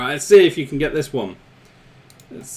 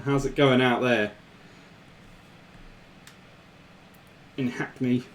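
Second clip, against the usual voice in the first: low, soft attempt at an East End accent; badly faked Cockney, trailing softer; terrible Cockney accent; 4 semitones lower, 6 dB quieter than usual. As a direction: put on a quiet, bad London accent. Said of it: "Excuse my terrible accent".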